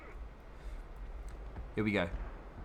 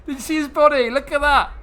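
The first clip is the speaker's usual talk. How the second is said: very high, loud gleeful squeal; excited squeal; 13 semitones higher, 10 dB louder than usual. Real, not acted. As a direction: squeal with delight at the sight. Gleeful over enemy corpse.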